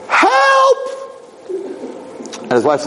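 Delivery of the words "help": very high, louder falsetto yelp; squeaky cry for help. Acted. The suit calling for help from the closet, in a squeaky yelp. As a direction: cry out like a tiny trapped creature. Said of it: "And the suit's like".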